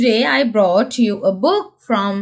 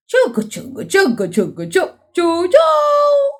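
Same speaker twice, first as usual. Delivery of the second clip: train chugging; 5 semitones higher than usual. Pretend. Train sound while children sleep.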